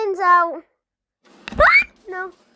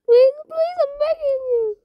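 begging; 6 semitones higher than usual. Real, not acted. Pleading at scary video.

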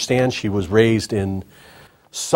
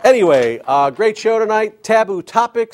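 1 excited intro; 9 semitones higher, 4 dB louder than usual. Acted. Host hyping guest intro.

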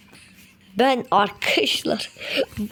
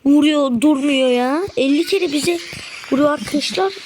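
high, exasperated gamer outburst; about to go crazy; 5 semitones higher, 3 dB louder than usual. Real, not acted. Gamer complaining to viewers about being hit nonstop, exasperated. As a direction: protest at higher pitch, fed up. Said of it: "Abi ben çıldıracağım".

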